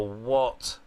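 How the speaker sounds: ordinary talk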